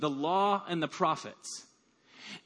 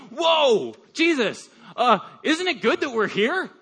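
sudden loud, higher blurt, half awake; groggy Peter blurting in excitement; startled blurt; 5 semitones higher, 6 dB louder than usual. Retold or imitated. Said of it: "half asleep, and is like".

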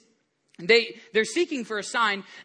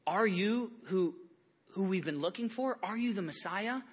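hushed plea; 9 dB quieter than usual. Retold or imitated. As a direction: ask softly like a searching prophet. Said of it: "John the Baptist going".

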